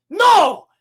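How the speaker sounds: loud, high dramatic "no"; hammed-up yell; imitated cry of no. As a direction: shout it as a mock rescuer. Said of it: "and Squid Game goes".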